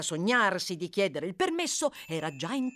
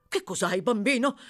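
urgent question, rising higher and louder; agitated cry; 4 semitones higher, 5 dB louder than usual. A narrator's reading. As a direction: cry out in alarm as the doctor. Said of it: "gridò il medico, agitatissimo". (grido agitato)

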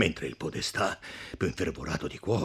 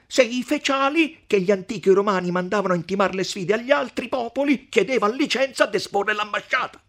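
higher, insistent debating; challenging podestà; 11 dB louder than usual. Acted. Podestà citing ancient Romans to count, challenging. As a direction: demand proof like a pedantic scholar.

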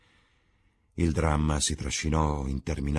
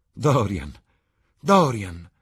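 louder joyful calling; ecstatic cry; 5 semitones higher, 9 dB louder, much slower than usual. Acted. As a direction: call out his name in rapture. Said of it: "gridò". (Sibyl grida il nome di Dorian, estatica)

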